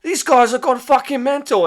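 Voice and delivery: fleeing soldiers crying out about the mad friars; panicked soldier. Retold or imitated. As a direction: yell it like men fleeing in terror. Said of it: "soldiers who are like".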